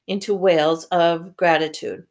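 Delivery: ordinary delivery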